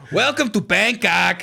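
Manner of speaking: slow, drawn-out mock Thai accent; hammed-up Thai accent, stretched slowly. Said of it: "Do you want me to say it like that?".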